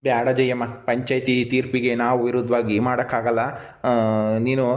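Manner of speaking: low-pitched, measured refusal; gently but firmly turn the gift down; grave, low voice; sober refusal. Acted. Brother soberly refusing sister's food.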